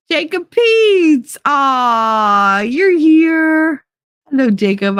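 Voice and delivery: high and loud, slowly calling out a name; excited greeting. Real, not acted. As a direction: hail a friend who just showed up. Greeting a viewer who arrived.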